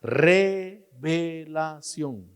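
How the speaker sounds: revelación stretched very slowly; drawn out word. Shown as a demonstration. Preacher stretching revelación out for the congregation. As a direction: let revelación hang long. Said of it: "A uno que tenía".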